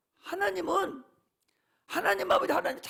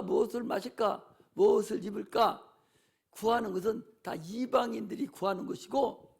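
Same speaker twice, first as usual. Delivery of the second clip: ordinary talk, nothing marked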